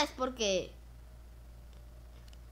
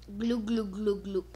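gulping noise; 4 semitones lower, slower than usual. Pretend. Drinking noise.

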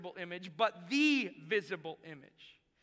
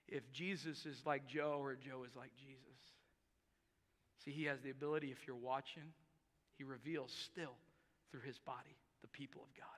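much softer, fading to a whisper; hushed; 9 semitones lower, 15 dB quieter than usual. Real, not acted. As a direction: draw them in quietly. Sermon drops to hushed close.

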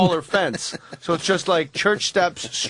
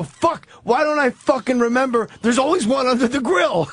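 imitating an exasperated loser, higher voice; frustrated; 6 semitones higher than usual. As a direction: kick yourself in mock frustration. Retold frustrated egg-hunt loser.

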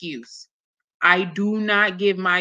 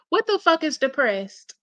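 ordinary delivery

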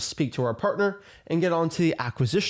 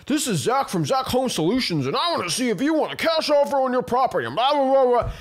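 a cheesy telemarketer rattling off his opener; salesman voice; 7 semitones higher than usual. Retold or imitated. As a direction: sell it like a slick cold caller. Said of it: "your tone sounds like a salesperson".

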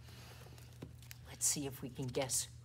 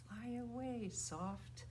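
hushed, gentle call; soft; 3 semitones higher, 9 dB quieter, slower than usual. Shown as a demonstration. Teacher sending the bird named Soft away, softly.

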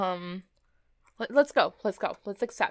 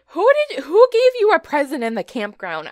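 much higher, louder, indignant cry; indignant outburst; 5 semitones higher, 13 dB louder than usual. Real, not acted. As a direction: shout it in outrage. Outraged at a game character.

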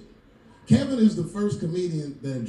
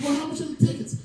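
incredulous dad; 12 semitones higher, faster than usual. Retold or imitated. Dad questions son.

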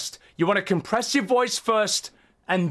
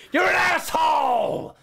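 slow, higher shouted insult; mock angry yell; 6 semitones higher than usual. Streamer scolding viewer's levels, hammed-up yell. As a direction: bark the insult.

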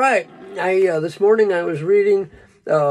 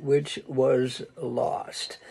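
hushed solemn; 6 semitones lower, 8 dB quieter, much slower than usual. Acted. Solemn end of scripture quote.

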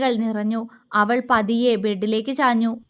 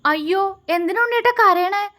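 anxious, much higher plea; alarmed that he is crying; wife anxiously asks her husband why he cries; worried; 7 semitones higher than usual. A narrator's reading. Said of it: "അവൾ ആകുലതയോടെ ചോദിച്ചു".

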